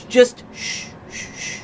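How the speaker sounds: hushed shh sounds, breath only; mock shushing. Talking to her heart, shushing it to quiet down. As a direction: shush your own heart so you can think. Hushing own heart in skit.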